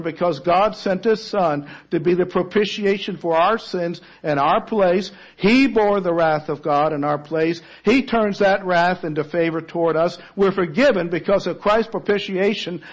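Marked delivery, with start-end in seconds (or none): none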